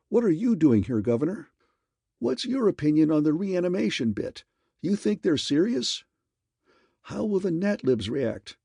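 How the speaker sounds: pepper him with questions; pushy reporters. A narrator's reading. Reporters blocking the governor's path, high pushy questions.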